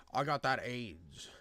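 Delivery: low, soft imitation of the rapper; mumbled low parody verse; mock rapper voice. Podcaster showing the rapper what bars to write, mock low voice. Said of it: "I need you to be like".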